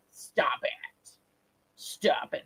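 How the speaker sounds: whisper. Real, not acted. Whispered stop it to caller.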